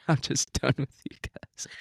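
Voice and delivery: breathy whisper, done with them; mock whispered brush-off. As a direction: whisper it like closing the door on them. Mock dismissive sign-off.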